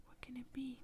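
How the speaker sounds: hushed worry at a strange shape in the dark; lightly acted timid wondering; mildly scared. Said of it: "gets very scared and stops and starts kinda shaking".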